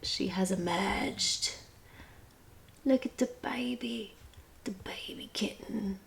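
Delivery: soft hushed whisper. Real, not acted. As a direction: whisper so the kitten isn't startled. Whispering not to scare cat.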